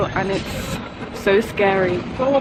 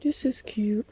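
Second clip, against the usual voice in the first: quiet whispered aside in a shop; hushed shop whisper; 7 dB quieter than usual. Real, not acted. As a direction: whisper it so nobody nearby hears. Trying on hats in shop.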